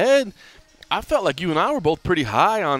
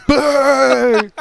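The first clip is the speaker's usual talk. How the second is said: playful boo, much higher and louder; mock boo; 13 semitones higher, 8 dB louder than usual. Host jokingly booing the fan-free fight night, loud mock jeer. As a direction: jeer like a pantomime crowd. Jokingly boos the empty arena.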